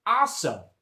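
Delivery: Boston accent. Shown as a demonstration. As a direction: toss it out in Boston dialect. Coach demonstrating the Boston dialect.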